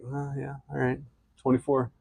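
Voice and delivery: ordinary talk, nothing marked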